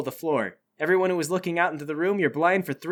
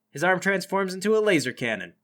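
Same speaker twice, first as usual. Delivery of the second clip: usual speaking voice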